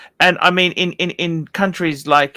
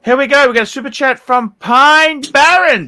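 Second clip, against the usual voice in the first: much higher and louder, announcing with big energy; hyped announcer; 8 semitones higher, 7 dB louder than usual. Acted. Cohost announces a viewer's super chat with hype. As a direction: punch it out like a game-show host.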